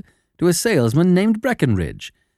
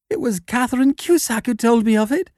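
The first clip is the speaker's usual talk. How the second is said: high, cracked little man's voice; pitched up high, crackling; 9 semitones higher than usual. A narrator's reading. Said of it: "said he in a crackling voice".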